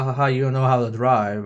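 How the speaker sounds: usual speaking voice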